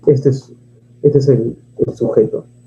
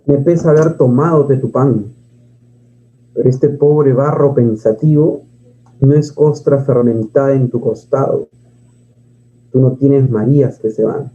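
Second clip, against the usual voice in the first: poem recitation. Acted. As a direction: recite the verses gravely.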